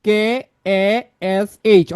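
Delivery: slow letter-by-letter spelling; measured, separated letter names. Shown as a demonstration. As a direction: spell it out slowly, a pause after each letter. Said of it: "আকাশ শব্দটা বানান করেন".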